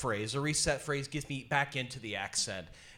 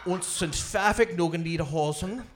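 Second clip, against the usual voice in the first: faked German with a put-on accent; slow, halting pretend German; mock German; 3 semitones higher, slower than usual. Shown as a demonstration. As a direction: bluff through gibberish in a German accent. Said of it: "Could you demonstrate".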